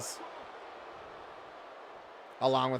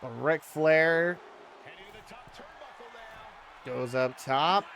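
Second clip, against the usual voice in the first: wrestling commentator; 5 semitones higher, slower than usual. Acted. Live wrestling game play-by-play.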